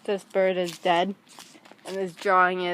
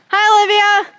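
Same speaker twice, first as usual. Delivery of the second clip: loud, high greeting call; 12 semitones higher, 11 dB louder, faster than usual. Real, not acted. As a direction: call the name out. Calling out a greeting.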